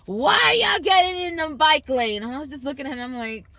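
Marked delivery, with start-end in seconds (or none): 0.1-2.2 yelled, louder and higher; mock angry yell at pedestrians; angry shout. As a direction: bark it like an annoyed cyclist. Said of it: "My man goes".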